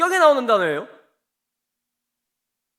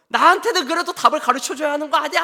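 high, louder imitation of a wailing wife; mock tearful demand; 7 semitones higher, 5 dB louder, faster than usual. Samson's wife demanding he tell her the answer, mock tearful. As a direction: push the complaint up high, as if crying. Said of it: "하면서 막 우는 거예요".

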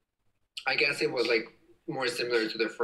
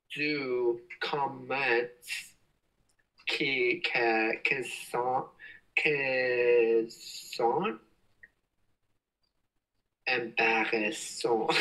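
slow halting French; much slower than usual. Real, not acted. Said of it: "How do you say embarrassing in French?".

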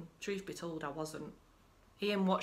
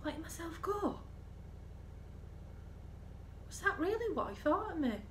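hurt disbelief; 9 semitones higher, 4 dB quieter than usual. Acted. Wife stung by husband's insult.

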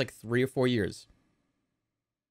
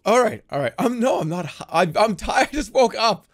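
usual speaking voice

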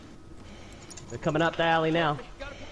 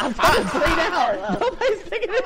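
laughing; 11 semitones higher than usual. Real, not acted. Downed, begging teammates, laughing.

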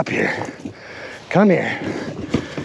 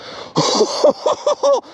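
incredulous laugh. Real, not acted. Stunned by the find.

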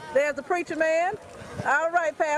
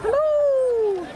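high, louder hello across the street; calling out; 12 semitones higher, 5 dB louder than usual. Real, not acted. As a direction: sing out a greeting to the crowd. Calling out to marchers passing.

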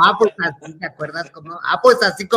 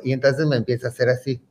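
ordinary talk

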